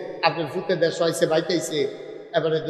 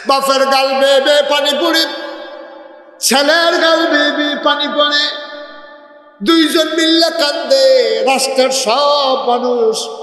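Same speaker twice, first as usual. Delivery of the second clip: high, loud wailing preach; father and son weeping, preached loud and high; impassioned preaching; 9 semitones higher, 6 dB louder than usual. Acted. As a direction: cry out the scene to the crowd. Preaching at full force.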